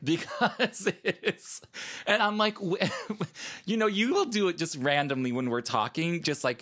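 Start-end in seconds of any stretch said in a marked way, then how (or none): 0.0-4.1 high, breaking into laughter; cracking up. Real, not acted. Host cracks up over co-host's American accent, laughing. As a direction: try to explain while laughing. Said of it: "crack me up every time".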